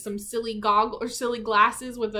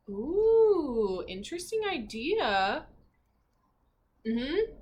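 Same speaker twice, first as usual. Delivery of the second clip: ordinary delivery, nothing marked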